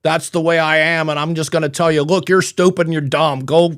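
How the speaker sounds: hammed-up dumb loudmouth; slack-jawed radio guy. Host mocks morning zoo DJ types, loud brash voice. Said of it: "you've got the guy who's the".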